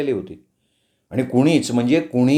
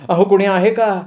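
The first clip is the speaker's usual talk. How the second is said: higher, more insistent call for help; 6 semitones higher than usual. A narrator's reading. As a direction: raise the pitch and call out to anyone around. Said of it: "ती सारखी मोठमोठ्यानं ओरडायला लागली". (मदतीसाठी चढ्या सुरात मारलेली हाक)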